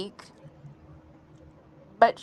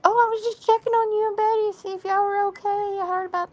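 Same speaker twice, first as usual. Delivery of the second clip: high, quick imitation of a concerned friend; reading friend's note; 11 semitones higher, much faster than usual. Reading Amber's note checking on them, high quick imitated voice. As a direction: pitch it way up and hurry through. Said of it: "It said".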